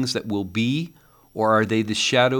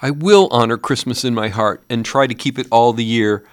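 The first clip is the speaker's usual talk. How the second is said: higher, louder desperate promise; agonized vow; 6 semitones higher, 7 dB louder than usual. A narrator's reading. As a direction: swear it in anguish. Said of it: "In his agony".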